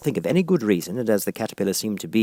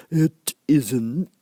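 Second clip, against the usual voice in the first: short, curt creature voice; caterpillar; 6 semitones higher than usual. A narrator's reading. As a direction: clip it flatly. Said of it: "said the caterpillar".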